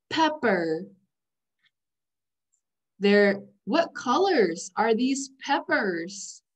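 usual speaking voice

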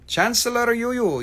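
"Chancellor" sung out high; greeting a viewer. Real, not acted. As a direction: call the name out cheerfully.

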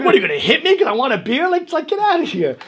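high, loud, fast taunt; mock defiant student; defiant challenge. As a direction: dare him to hit you. Arguing with football player.

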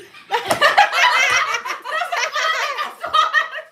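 high, loud laughing fit; burst of laughter. Real, not acted. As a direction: let out a long, high laugh. Risa por la broma. (carcajada larga y aguda)

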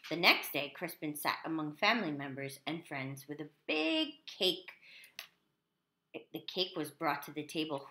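ordinary delivery

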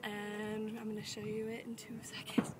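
breathless, half-whispered words; winded whisper after a hard climb; out of breath. Real, not acted. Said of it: "after I catch my breath".

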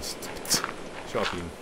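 whispering. Real, not acted. Hissing back at a teasing friend.

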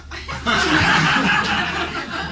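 breathy laughter; real laugh. Real, not acted. Telling the creepy neighbour story, laughing. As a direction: crack up at the memory.

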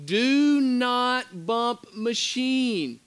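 "Do not bump machine" drawn out very slowly, each word spelled out; slow sign reading. Retold or imitated. Preacher rereads the sign to the church, very slowly. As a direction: read "Do not bump machine" like a solemn warning.

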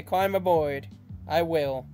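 ordinary delivery

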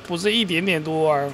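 ordinary delivery

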